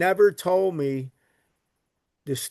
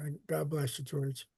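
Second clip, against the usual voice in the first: much softer, the voice catching with emotion; a real lump in the throat as the words fade away; choked up; 11 dB quieter than usual. Real, not acted. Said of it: "Choked up a little bit".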